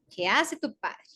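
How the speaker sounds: ordinary talk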